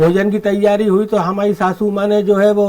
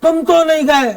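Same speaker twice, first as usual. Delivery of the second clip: higher, measured stage verse with ringing line ends; comic poem recitation; 5 semitones higher than usual. Acted. Poet reciting a police satire to a festival audience, declaimed comic verse.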